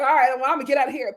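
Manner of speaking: very fast hurried getaway; rushed exit line. Retold or imitated. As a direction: rattle it off while leaving. Jalen hurrying away.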